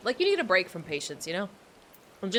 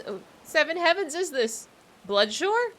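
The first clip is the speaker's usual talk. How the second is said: ordinary talk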